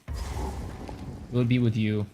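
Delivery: slow, drawn-out mock tender line; slow, hammed-up romantic declaration; mock romantic confession. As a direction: linger on it like a corny love confession. Confessing dream dinner date to Sanchez.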